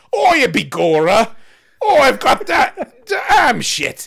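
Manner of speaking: high, loud, slow Cockney wail; loud laughing Cockney complaint; peasant accent. Acted. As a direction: shriek it as a laughing, sobbing serf.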